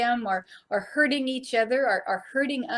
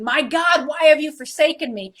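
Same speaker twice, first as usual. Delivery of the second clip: louder, raised anguished plea; anguished cry; 4 semitones higher, 6 dB louder than usual. Retold or imitated. Preacher voicing Jesus calling out on the cross, anguished. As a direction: cry out as if abandoned.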